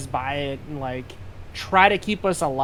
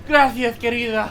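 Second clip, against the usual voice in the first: collectively heaving; breathless thanks while heaving; heaving breath; 6 semitones higher, 3 dB louder than usual. Acted. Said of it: "like, collectively heaving".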